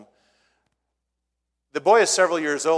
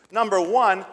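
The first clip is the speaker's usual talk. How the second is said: ordinary talk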